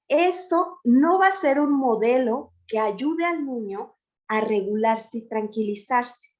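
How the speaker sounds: normal talking voice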